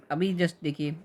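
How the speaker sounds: ordinary delivery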